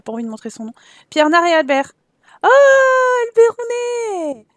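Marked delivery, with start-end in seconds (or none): 2.4-4.5 much higher, louder, drawn-out delight; excited greeting. Pretend. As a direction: greet him like a long-lost friend. Greeting a visiting Sim. (accueil ravi, aigu et appuyé)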